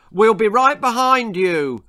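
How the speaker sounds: high, very loud projected call; loud staged call; loud, to carry. A narrator's reading. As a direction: make sure the boy hears through the window. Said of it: "shouted in a loud voice designed to carry".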